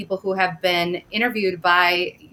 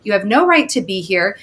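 usual speaking voice

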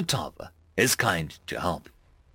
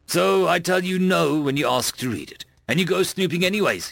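growled; 6 semitones higher than usual. A narrator's reading. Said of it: "he growled".

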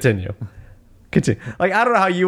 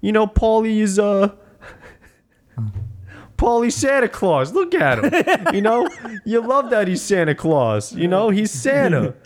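dopey Rocky voice; 7 semitones higher than usual. Retold or imitated. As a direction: play it dim and bewildered. Son defies Rocky, Rocky voiced.

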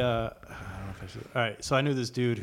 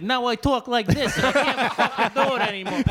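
exaggerated mobster-actor mimicry, pitched way up; Joe Pesci; 13 semitones higher, 7 dB louder than usual. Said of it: "talking like Joe Pesci".

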